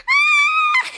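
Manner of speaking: loud, very high shriek; piercing cry of frustration; frustrated scream. Real, not acted. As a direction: scream out pent-up exasperation. Frustrated scream after the rant.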